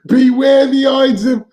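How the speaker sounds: high, loud prophetic soothsayer voice; soothsayer warning. Acted. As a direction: boom out a dire prophecy. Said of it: "as the soothsayer said".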